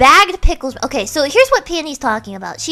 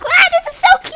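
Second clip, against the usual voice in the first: excited; faster than usual. Retold or imitated. Gushing over tortoise.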